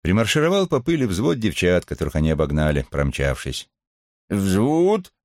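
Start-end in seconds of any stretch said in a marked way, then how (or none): 4.3-5.1 high barked platoon command; shouting drill sergeant. A narrator's reading. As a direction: bark the order at the squad.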